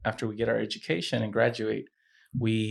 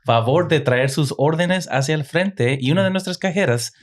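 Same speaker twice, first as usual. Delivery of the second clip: loud, raised-pitch Spanish closing announcement; store announcement; 3 semitones higher, 5 dB louder than usual. Shown as a demonstration. Manager telling customers the store closes soon, loud announcer delivery. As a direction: project to the whole store like a radio announcer. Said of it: "it can sound, like, a little bit like this".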